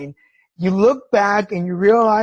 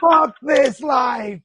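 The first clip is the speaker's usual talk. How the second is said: loud, high, long-drawn mock cry; frustrated outburst; 7 semitones higher, 6 dB louder, much slower than usual. Cancer patient sick of showers, frustrated shout. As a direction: act out being utterly fed up, stretching each word. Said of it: "I was tired, I was frustrated".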